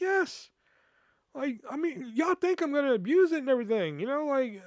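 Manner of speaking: high-pitched retold indignation; incredulous, pitched high; exasperated protest. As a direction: protest as if it's obvious, voice way up. Acted exasperated answer to manager.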